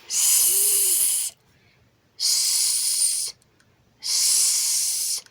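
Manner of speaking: long pure hiss, sssss, no vowel; isolated s sound. Shown as a demonstration. Saying a sound for the child to point to, hissed. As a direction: hold "S" as a drawn-out hiss. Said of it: "So I might go".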